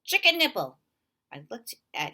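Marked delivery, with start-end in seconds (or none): 0.0-0.8 sudden loud high shout. A narrator's reading. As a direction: blurt it out as a tic. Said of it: "greeted by a boy shouting".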